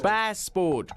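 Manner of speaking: passport split into two stretched syllables; pronunciation drill. Shown as a demonstration. Trainer leading pronunciation exercise, passport drawn out and exact. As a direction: stretch both halves of passport.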